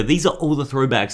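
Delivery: ordinary talk, nothing marked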